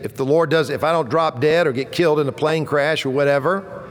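normal talking voice

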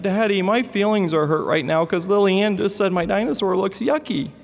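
put-on high, wounded little boy complaining to dad; hurt little boy. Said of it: "he's like".

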